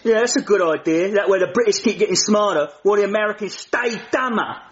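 put-on cheeky cockney-style British accent; mock London accent. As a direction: lay the British accent on thick. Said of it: "I don't like his accent".

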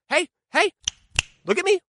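put-on high, sing-song mom calling kids to attention. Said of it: "she would say".

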